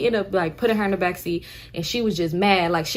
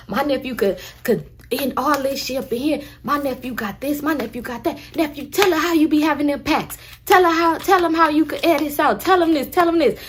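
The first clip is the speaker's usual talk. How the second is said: bragging aunt; 6 semitones higher than usual. Retold or imitated. Aunt brags to cashier.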